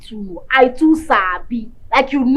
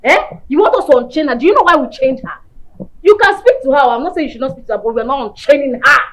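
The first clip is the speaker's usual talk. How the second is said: loud, heated scolding refusal; angry refusal; 2 semitones higher, 11 dB louder than usual. Acted. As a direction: shut her down angrily. Refusing to unchain friend.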